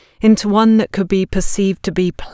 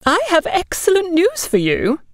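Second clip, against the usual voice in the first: bright, much higher, eager announcement; beaming; 12 semitones higher than usual. Acted. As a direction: gush out the good news. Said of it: "Agatha beamed".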